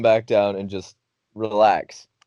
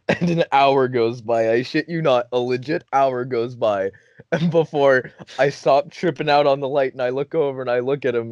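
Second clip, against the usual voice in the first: normal talking voice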